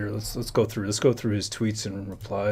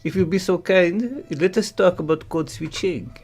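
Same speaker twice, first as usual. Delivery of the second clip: courteous mock French host; English with French vowels; French accent; 9 semitones higher, 6 dB louder than usual. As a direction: invite him in with a put-on French accent.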